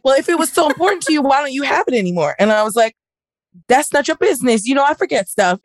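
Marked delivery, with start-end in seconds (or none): 0.0-2.3 higher, quick mock challenge; mocking boyfriend. 3.7-5.6 higher, half-shouted comeback; snappy retort. Retold or imitated. Retells her snappy answer.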